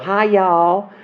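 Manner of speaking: a sweet little Southern hello, sung out high; sweet Southern greeting. Shown as a demonstration. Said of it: "the little sweet little".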